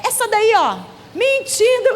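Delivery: high, indignant tattling; imitated angry child. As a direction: accuse her like an upset child. Retold child accusing his mother.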